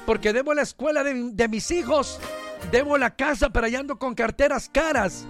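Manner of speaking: usual speaking voice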